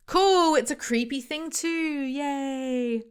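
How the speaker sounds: high, squeaky mock cheer; sarcastic. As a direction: fake glee, dripping sarcasm. Sarcastic mock cheer at creepy gift.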